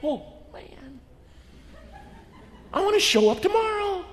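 high-pitched, gleeful amazement; delighted worker. Acted. Overpaid worker gloating about the landowner, gleeful. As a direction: marvel in a high, thrilled voice. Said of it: "and went".

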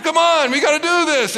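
high, emphatic plea; hammed-up desperate dad; desperate urging. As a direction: badger the kids into it. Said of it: "I was getting desperate".

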